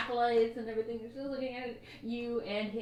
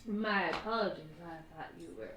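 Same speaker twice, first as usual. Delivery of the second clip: put-on disappointed drunk; 5 semitones lower, 4 dB quieter than usual. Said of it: "he's like".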